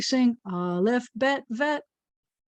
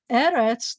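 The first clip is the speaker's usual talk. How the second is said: "eretz" louder and slow, first e stressed; 2 semitones higher, 4 dB louder than usual. Shown as a demonstration. Teacher modelling Hebrew stress for children, slow and firm. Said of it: "the accent's on the first one".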